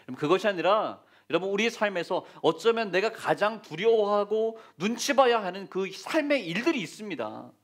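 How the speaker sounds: normal talking voice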